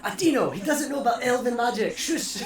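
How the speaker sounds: high, loud laughing hush. Real, not acted. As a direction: laugh while shushing him. Laughing, hushing brother.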